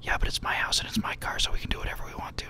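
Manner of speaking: fast, sly whisper; mischievous whisper. Retold or imitated. Bert whispering that it's his house and car. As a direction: whisper it quickly like a co-conspirator. Bert's retold whispered answer.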